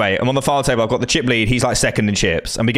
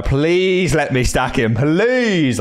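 drawn-out, rising plea; exaggerated longing, higher pitch; mock pleading; 5 semitones higher, much slower than usual. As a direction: beg slowly, stretching each please. Acting out his desperate wish.

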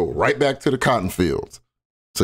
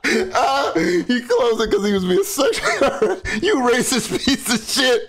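high, words squeezed through laughter; can barely speak for laughing; real helpless laughter; laughing fit; 13 semitones higher than usual. Real, not acted.

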